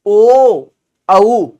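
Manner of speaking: ओ and औ held long and loud; long vowels held; drawn-out vowels. Shown as a demonstration. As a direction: stretch each vowel out. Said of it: "खींच के बोलेंगे ना".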